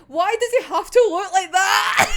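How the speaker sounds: high, loud mock complaint; exasperated shout cracking into a laugh; exasperated wail. Real, not acted. Mock complaint about attractive character.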